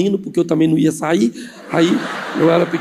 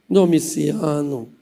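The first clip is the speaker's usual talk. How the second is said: low, slow wheedling of a husband; sweet coaxing; 4 semitones lower, much slower than usual. Acted. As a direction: draw the name out low and gently. Aunt softly wheedles her husband.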